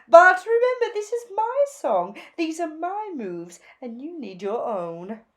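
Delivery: high, loud, gushing fashion bird; gushing Zelda. A narrator's reading. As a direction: gush over the new look like a flamboyant stylist. Zelda voiced.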